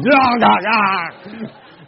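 loud comic yelps of spider panic; re-enacted frantic "ah" cries; panicked yelling. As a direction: yelp in mock horror, flailing off a spider. Acts panic at spider falling on him.

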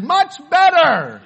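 loud, high, stretched-out call; slow, hammed-up shout of relief; calling out approval. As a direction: imitate a director hollering over the choir. Director calls out over singing choir.

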